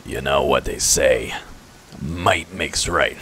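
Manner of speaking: low, slow character voice; Ragongar. Acted. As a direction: drop it low and savour the pun. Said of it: "Ragongar says".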